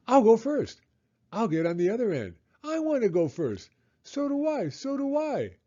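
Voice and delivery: high, eager overlapping cries; children shouting excitedly. A narrator's reading. Children crowd the seesaw, excited shouting. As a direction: clamour to go first. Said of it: "shouting with excitement".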